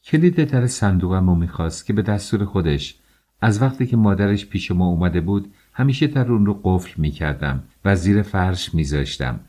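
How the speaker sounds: ordinary delivery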